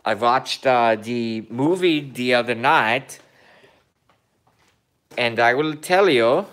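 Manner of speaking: a light mock Kazakh Borat accent in broken English. Vlogger joking to viewers in a light mock Borat accent. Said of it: "I am doing accent of a Borat".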